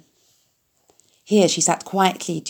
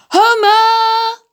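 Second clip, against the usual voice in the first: very high, loud yell; cried out; 8 dB louder than usual. A narrator's reading. Mrs. Zuckerman yelling from the kitchen window, very high and loud. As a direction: call across the farm. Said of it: "she cried".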